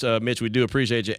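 normal talking voice

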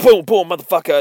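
loud, high mock gun-blast yell; acted shout. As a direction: shout it like a shotgun going off. Acted character firing shotgun, shouting.

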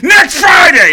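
sudden high, full-force yell; very loud, high-pitched outburst; Tracy Morgan impression. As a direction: explode with the answer. Impression suddenly yells the answer.

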